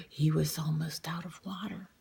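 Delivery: hushed and anxious; soft, worried hush; worried whisper. Real, not acted. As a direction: whisper in dismay. Gasp then whisper.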